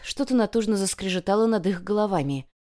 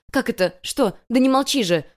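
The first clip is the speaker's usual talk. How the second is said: higher, urgent, rising to a louder demand; alarmed; 6 semitones higher, 5 dB louder than usual. A narrator's reading. Girl finds her wounded friend bleeding, alarmed. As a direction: press him for an answer in alarm.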